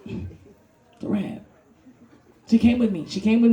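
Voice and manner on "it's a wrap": soft, very slow "It's a wrap"; whispered. Acted. Comic resigning, whispered wrap. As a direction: breathe out "It's a wrap" slowly. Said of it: "deadpan whisper repeat".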